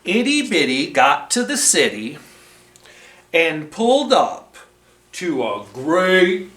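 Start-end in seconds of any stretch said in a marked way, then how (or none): none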